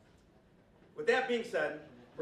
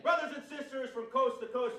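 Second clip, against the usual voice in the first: raised voice, higher pitch; rally speech; 7 semitones higher than usual. Real, not acted. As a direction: hail the crowd from the stage. Greeting the rally crowd.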